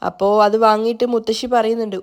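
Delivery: ordinary delivery, nothing marked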